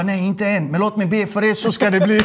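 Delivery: high, eager promise; excited reply. Retold or imitated. To the flight attendants, eagerly promising a prayer, high. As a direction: tell them eagerly, voice lifted. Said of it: "Jag bara".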